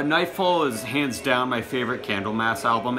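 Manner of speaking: ordinary delivery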